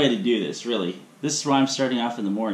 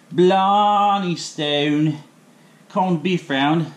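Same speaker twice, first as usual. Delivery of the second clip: slow, louder mock Irish brogue; horrible fake Irish accent; 5 semitones higher, 4 dB louder, much slower than usual. Cook naming the Saint Patrick's beef in a fake Irish accent. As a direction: stretch it out in a hammed Irish lilt. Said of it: "horrible fake accent there".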